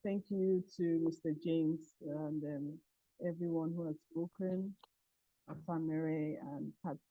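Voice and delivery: very faint, distant voice; weak, far-off speech; barely audible. Real, not acted. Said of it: "Can you be a little bit louder?".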